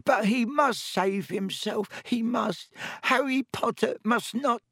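high, slow house-elf moan, torn between misery and rapture; a tearful elf moaning in awe; miserable ecstasy. A narrator's reading. Dobby moaning to Harry in miserable ecstasy.

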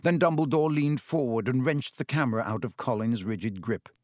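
plain reading voice

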